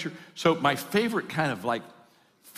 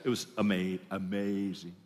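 low, soft, savoured amazement; quiet awe; 5 semitones lower, 6 dB quieter than usual. Acted. Returned customer tells the barber of his trip, in hushed awe. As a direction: let the wonder come out low and hushed.

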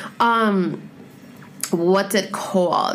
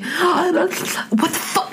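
gasp, then a slow, stunned higher what the fuck; shocked gasp; 8 semitones higher, much slower than usual. Real, not acted. To her viewers, real shock at the book. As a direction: caught off guard, breath sucked in. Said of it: "let me relax".

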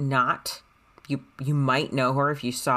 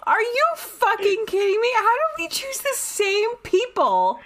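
real incredulous squeal. Real, not acted. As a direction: squeal the question, utterly stunned. Stunned at the coincidence.

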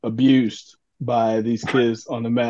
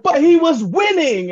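indignant mom; 14 semitones higher, 3 dB louder than usual. Retold or imitated. Mom answering the coach.

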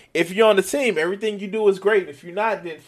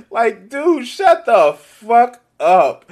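ordinary talk, nothing marked

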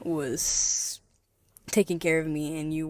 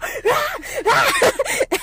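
shrieking laugh, much higher and louder; 10 dB louder than usual. Real, not acted. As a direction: burst out laughing high. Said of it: "laughs after impression".

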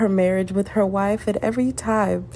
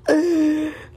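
high burst of laughing; laughter; 10 semitones higher than usual. Real, not acted. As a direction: crack up for a moment. Laughing about friends' reactions.

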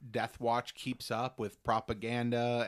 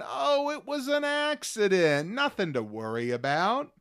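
mock dismissive investigators; 10 semitones higher, 5 dB louder than usual. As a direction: stretch it out sky-high, sweetly waving it away. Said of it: "all the investigators are going".

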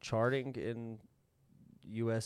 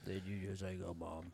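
much softer, lower, almost murmured; hushed echo; 5 semitones lower, 13 dB quieter than usual. Retold or imitated. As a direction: drop the voice right down. Co-host echoes the quoted line.

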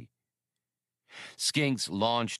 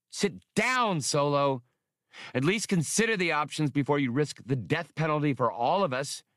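heated command; 7 semitones higher than usual. A narrator's reading. Hasty ordering Solo to sit, heated and higher. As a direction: order him down sharply.

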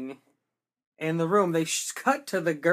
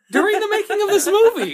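excited; 14 semitones higher, 10 dB louder than usual. Real, not acted. Exclaiming in disbelief.

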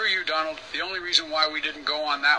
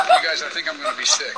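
queasy laughing; 9 dB louder, faster than usual. Acted. Queasy on coaster.

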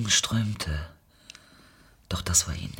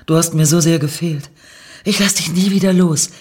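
exultant; 6 semitones higher, 5 dB louder than usual. Acted. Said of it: "jauchzte Lorna".

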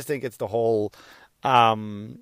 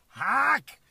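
mock heckler; 11 semitones higher, 4 dB quieter than usual. Shouted heckle at own joke.

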